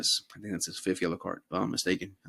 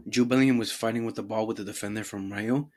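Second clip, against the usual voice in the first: ordinary talk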